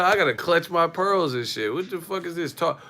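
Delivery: ordinary talk, nothing marked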